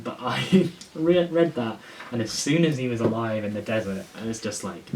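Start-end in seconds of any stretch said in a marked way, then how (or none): 0.2-1.8 high, loud, slowed by real laughter; laughing. Real, not acted. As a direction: laugh through the words at yourself. Laughs at own rant.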